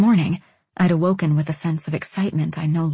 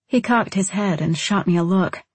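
usual speaking voice

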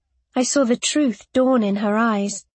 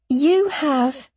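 croak; 3 semitones higher than usual. A narrator's reading. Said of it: "my voice came out as a croak".

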